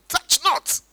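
high, emphatic mock decree; sanctimonious put-on commandment; mock super-spiritual. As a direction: proclaim it like the over-pious. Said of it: "feel very spiritual saying".

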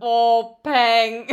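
loud startled outburst; jolted by the question, laughing; surprised. Real, not acted. (überraschter Ausruf)

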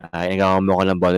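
low, flat-toned robot voice; robotic neutral. Shown as a demonstration. Friend mocks saying anger too neutrally, low like a robot. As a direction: drone it like an emotionless robot. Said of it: "robot ka na eh".

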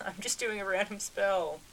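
high-pitched crow character voice; laugh, then answer in a high little voice; Sick. Acted. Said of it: "Is Sick's voice deeper?".

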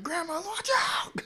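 high falsetto warning yell; squeaky high watch out cry; mock warning cry. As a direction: shout a cartoonish warning. Warning grandma about sled.

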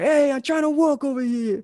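high, loud New York tough-guy complaint; mock New York accent. Host imitating an annoyed New Yorker in a mock New York accent. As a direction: snap like a busy New Yorker. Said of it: "talking in that accent, like you're from New York".

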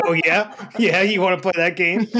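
mock tough-cop challenge; lightly voiced cocky New Jersey cop; New Jersey cop asshole. Retelling a standoff with a bear, New Jersey cop attitude. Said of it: "like, New Jersey cop asshole on the bear".

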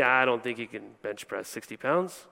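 lightly voiced skeptic, low and soft; a hint of a doubter's undertone; mock doubter. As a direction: drop into a low, muted aside as a doubter. Voicing a stubborn doubter.

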